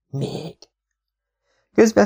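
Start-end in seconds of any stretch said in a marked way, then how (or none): 0.1-0.6 whispered. A narrator's reading. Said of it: "a fülembe súgta".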